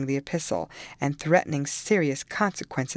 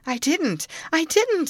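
high, louder sobbing denial; girl sobbing her denial to the nurse; 13 semitones higher, 4 dB louder than usual. A narrator's reading. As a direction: sob it out, heartbroken. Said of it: "sobbed Cathy".

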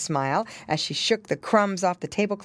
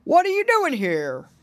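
loud, raised-pitch hearty question; hailing Santa; 11 semitones higher, 5 dB louder than usual. A narrator's reading. Santa surprised by piggy boys, loud hail. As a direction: call it out in a big Santa voice.

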